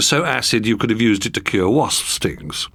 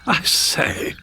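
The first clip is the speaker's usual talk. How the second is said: exclaimed; 7 semitones higher than usual. Acted. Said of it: "he exclaimed".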